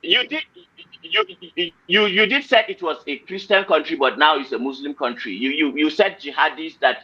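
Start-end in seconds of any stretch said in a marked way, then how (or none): 0.0-4.0 heated protest. Real, not acted. Indignantly insisting to the guest who denies it, pitch raised high.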